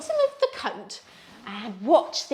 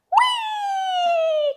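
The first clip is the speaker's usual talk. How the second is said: much higher, louder falling whee; slide whee; 6 dB louder than usual. Acted. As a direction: ride the slide down with joy. Going down the slide.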